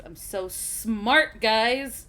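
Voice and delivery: slow, loud mock brag; sarcastic boast. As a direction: drag it out like a smug brag. Ironic boast before roll.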